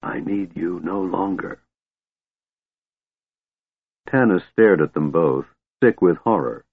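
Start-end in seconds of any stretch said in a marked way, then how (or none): 0.0-1.6 very soft, breath-like dismissal; whispered, sighing. A narrator's reading. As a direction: dismiss him in a near whisper. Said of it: "whispered with a soft sigh".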